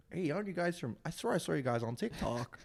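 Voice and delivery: a knowingly bad American accent; Aussie doing a hammed-up American accent. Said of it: "butchering the accent".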